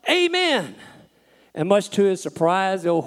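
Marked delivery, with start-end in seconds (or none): 0.1-0.8 very high, sharply falling cry; panicked yell, acted in full; frantic shout. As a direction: shout it to halt a runaway horse. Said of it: "And he shouts".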